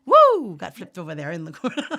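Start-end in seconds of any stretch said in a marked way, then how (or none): 0.0-0.5 high loud startled "Woo!"; sudden surprised cry; surprised whoop. Real, not acted. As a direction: yelp as the camera falls. Camera flipped over.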